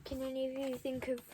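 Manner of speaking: ordinary delivery, nothing marked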